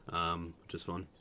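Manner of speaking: normal talking voice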